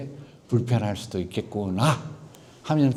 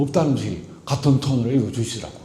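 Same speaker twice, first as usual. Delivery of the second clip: ordinary delivery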